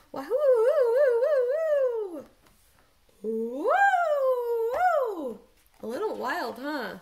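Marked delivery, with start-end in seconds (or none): 0.1-5.4 high, loud bouncing whoops; excited whoops. Shown as a demonstration. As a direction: whoop like on a trampoline. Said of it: "They're on a trampoline".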